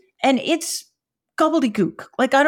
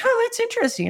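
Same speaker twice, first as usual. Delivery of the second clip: mild surprise; 11 semitones higher than usual. Retold or imitated. Retold surprised reaction.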